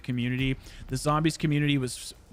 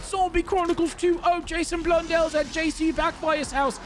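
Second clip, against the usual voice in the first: high-pitched mock-excited YouTuber; squeaky mocking hype; hype YouTuber parody; 13 semitones higher than usual. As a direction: parody over-hyped video intros in a high voice. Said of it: "YouTubers try to capitalize on that stuff. And they're like".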